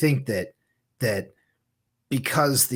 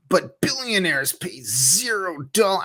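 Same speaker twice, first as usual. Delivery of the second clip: nasal voice reading a tweet; Kirk nasally voice; 8 semitones higher, 4 dB louder than usual. Pretend. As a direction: read it through the nose, mocking. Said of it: "I gotta do the whole Kirk nasally voice".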